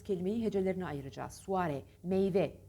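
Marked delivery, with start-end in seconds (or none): none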